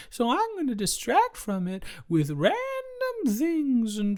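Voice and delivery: high mocking imitation of a partner. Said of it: "You're the one who's like".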